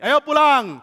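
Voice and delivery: high, loud holler; shouted marching order; rallying call. Acted. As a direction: call the troops to head home. Saul mengajak pasukannya pulang.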